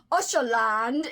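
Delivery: put-on an exclaimed southern-accent Usherland. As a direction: imitate the audiobook's southern Usherland. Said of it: "they say it with a southern accent in the audiobook".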